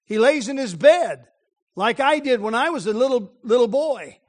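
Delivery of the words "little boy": ordinary talk, nothing marked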